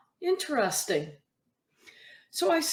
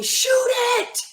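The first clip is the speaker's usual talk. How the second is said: mock parent yell; 13 semitones higher, 4 dB louder than usual. Parent yelling from the stands.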